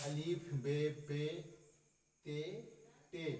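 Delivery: alif be pe recited slowly; slow listing of alif, be, pe; slow recital. Shown as a demonstration. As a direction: pace it out, letter by letter. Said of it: "names the alphabet".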